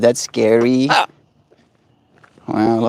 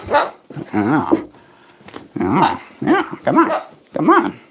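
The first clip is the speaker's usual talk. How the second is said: talking to a pet; 5 semitones higher than usual. Acted. Coaxing pet bird.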